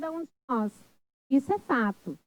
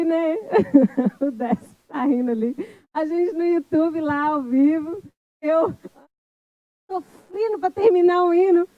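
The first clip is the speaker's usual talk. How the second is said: amused, higher and louder, laughter breaking in; laughing while talking; 4 semitones higher, 5 dB louder than usual. Real, not acted. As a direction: tell it through real laughter. Laughing over her failed live singing. (falando entre risadas)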